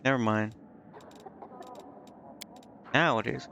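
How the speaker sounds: usual speaking voice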